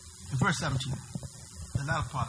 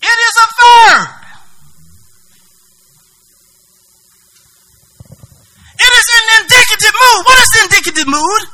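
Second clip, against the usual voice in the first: loud, high teaching cry; preaching at full voice; 6 semitones higher, 9 dB louder than usual. Real, not acted. Preacher exciting the congregation over Greek grammar, loud. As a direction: proclaim each grammar point.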